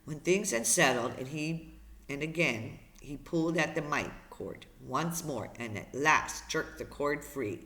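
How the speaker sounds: plain reading voice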